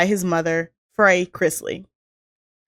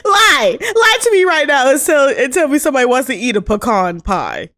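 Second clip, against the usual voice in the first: loud and high through laughter; shouting a playful challenge mid-laugh; laughing mock demand; 6 semitones higher, 6 dB louder than usual. Real, not acted. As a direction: dare her while cracking up.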